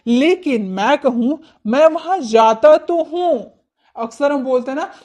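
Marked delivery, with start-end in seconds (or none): 1.7-3.5 मैं वहाँ जाता तो हूँ with weight on तो, higher and louder; emphatic stress. Shown as a demonstration. Teacher shows students the emphatic sentence with stress. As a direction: press hard on तो. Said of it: "तो जोर देकर हम बोल रहे हैं".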